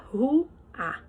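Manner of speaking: "ru-a" divided between the vowels; split into syllables. Shown as a demonstration. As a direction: separate "ru" from "a" for the class. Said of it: "separando a sílaba".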